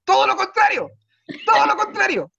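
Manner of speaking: high, loud indignant yell; outraged shout. Real, not acted. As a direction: bellow it in disbelief. Outraged punchline to audience.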